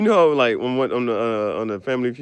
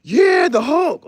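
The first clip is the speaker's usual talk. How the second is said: high, loud, slow cheering; mock hype cheer; 8 dB louder, much slower than usual. Hyping the Hulk saving the movie, high loud cheer.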